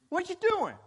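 high, sharp challenge; acted Martha. Martha challenges her idle sister, high and sharp. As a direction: demand to know what she's doing.

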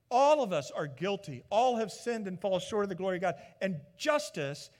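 a preacher pressing sin and justice, loud; preaching at full force. Acted. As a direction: drive the gospel home. Preaching climax.

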